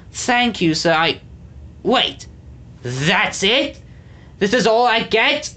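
put-on high, rising old man's outrage; exclaimed in disbelief. A narrator's reading. The old man stares at his tiny cheese, exclaiming. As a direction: sputter out the disbelief. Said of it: "the old man exclaimed".

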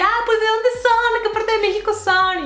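high-pitched eager questioning; super excited. Retold or imitated. Asking fellow Mexicans where they're from, super excited. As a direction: gush the questions, voice way up. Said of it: "yo me súper emocioné".

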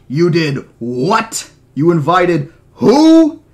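slow, loud incredulous yell; outraged disbelief, rising into a drawn-out shout; screaming in outrage. Acted. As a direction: yell it, stretching the last word. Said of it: "Gennaro was screaming over the sound".

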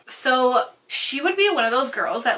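usual speaking voice

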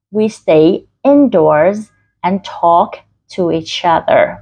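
very slow, clearly articulated reading; slow, word by word. Shown as a demonstration. Teacher models the exercise for learners, slow distinct words. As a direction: draw out each word distinctly. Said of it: "các bạn đọc rõ từng từ".

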